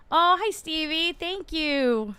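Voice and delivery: ordinary talk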